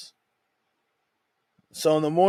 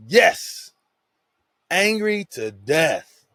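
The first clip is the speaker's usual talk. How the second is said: loud, higher mock-angry answer, slowing at the end; angry retort; 4 semitones higher, 5 dB louder, much slower than usual. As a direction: snap back in exaggerated anger. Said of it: "He says".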